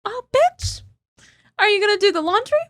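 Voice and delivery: high, squealing mock of him. Wife mocking husband's complaints in a high voice.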